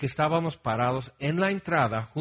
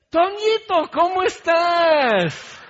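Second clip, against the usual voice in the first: far higher, slow, sugary welcome; hypocritical sweet greeting; 9 dB louder, slower than usual. Retold or imitated. Couple greeting the visitor right after fighting, fake sweet. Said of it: "Hipócritas, o sea, terriblemente hipócritas".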